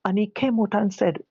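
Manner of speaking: ordinary delivery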